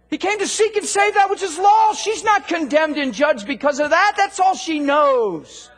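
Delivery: loud, high, driving sermon delivery; fiery preaching. Acted. Preacher fires up congregation about commanding healing, loud and driving. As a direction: preach it hard to the crowd.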